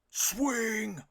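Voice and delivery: loud, high shout of swing; hollering. A narrator's reading. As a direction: yell it from the stands. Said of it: "hollered Papa".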